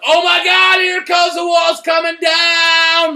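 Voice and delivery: high, loud, half-sung shout; freaked-out shout. Retold or imitated. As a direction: belt it out like the wall is falling right now. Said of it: "I was like".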